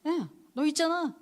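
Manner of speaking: high, loud, bright-eyed reassurance from a student; a student's high excited comfort; excited girl. Retold or imitated. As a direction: cry it out high like an eager schoolgirl. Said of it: "학생 중에 하나 딱 그런 거야".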